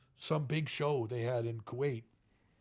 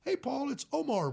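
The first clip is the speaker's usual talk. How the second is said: higher-pitched imitation of an English caller; lightly put-on English accent on a phone greeting; 10 semitones higher than usual. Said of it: "with this English accent".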